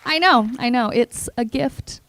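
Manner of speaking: ordinary talk, nothing marked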